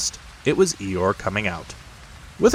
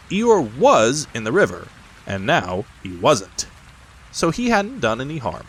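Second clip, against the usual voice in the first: ordinary delivery, nothing marked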